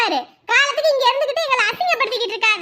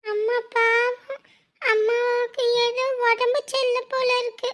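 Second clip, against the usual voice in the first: soft, slow, worried murmur; quiet worried child; 5 dB quieter than usual. Acted. Child worrying softly about sick mother, slow and soft. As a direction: whisper your fear for mother.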